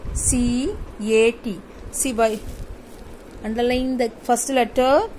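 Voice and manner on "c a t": letters spelled out slowly, one by one; teacher spells the word cat for the class, slow and clear; slow spelling. Shown as a demonstration. Teacher spells each letter of cat for children, slowly.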